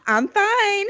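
high forced I'm fine; fake fine. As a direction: pretend you're fine through tears. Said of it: "crying and being like".

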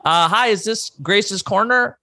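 high, tentative phone customer; role-play caller. Pretend. Customer calling Gracie's Corner, high put-on phone voice. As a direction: pitch it up like a polite caller. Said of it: "Okay, I'ma call in".

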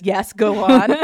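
loud mock-eager urging; imitating an overeager listener; too enthusiastic. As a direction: overdo the eagerness, playing it for laughs. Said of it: "he too enthusiastically says".

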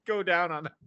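ordinary delivery

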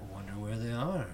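serial killer voice. Retold or imitated. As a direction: murmur it like a lurking serial killer. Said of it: "he is speaking like a serial killer".

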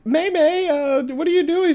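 high-pitched mom calling out; mimicking a mom checking on her kid; imitated movie mom. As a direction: put on a high, inquiring mom voice. Voicing the film's mom.

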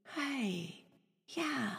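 soft, high, tender coo; gentle heart voice. Acted. The voice of your heart greeting you, soft and tender. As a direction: greet very softly with a lifted pitch, letting each word fall. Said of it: "the voice of your heart is".

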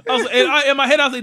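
ordinary talk

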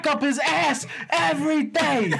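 mock whiny son. Son complaining to mom about his brother, high slow rant. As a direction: ham up the son ranting to ma.

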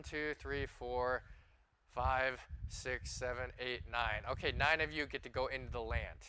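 normal talking voice

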